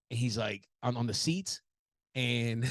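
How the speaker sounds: ordinary delivery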